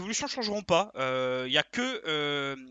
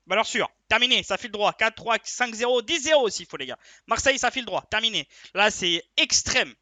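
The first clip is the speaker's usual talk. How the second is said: raised, loud, punchy declarations; hyped certainty; 4 semitones higher, 6 dB louder than usual. Real, not acted. Tipster telling the guys Marseille is a sure thing, hyped. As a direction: sell it as a done deal, emphatic and excited.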